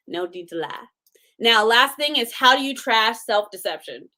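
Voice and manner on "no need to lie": "No need to lie" echoed, softer and lower; softer repeat. Shown as a demonstration. Repeating "No need to lie" to the audience, softer. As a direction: let the echo drop softly.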